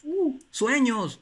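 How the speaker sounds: very high, slow, drawn-out call; boy yelling into the echo; stretched shout. Retold or imitated. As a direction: stretch sueños out like a call into a canyon. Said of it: "empezaba a gritar el niño".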